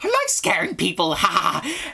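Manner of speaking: creepy child with a mock maniacal laugh; gleeful little psycho, laughing at the end; psychopathic little girl. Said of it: "You're making her sound so crazy".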